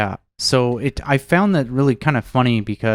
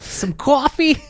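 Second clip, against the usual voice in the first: very high mock Boston "coffee"; old-lady Boston echo; thick Boston accent; slower than usual. Echoing the grandmother impression, high thick Boston accent. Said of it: "super thick accent".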